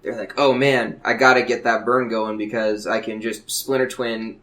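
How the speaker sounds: ordinary delivery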